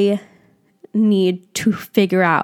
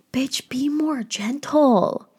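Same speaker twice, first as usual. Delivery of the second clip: slow whispered reminder; slow, soft whispered self-talk; 4 semitones higher, 4 dB quieter, much slower than usual. Real, not acted. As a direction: breathe it out slowly, a whisper to yourself.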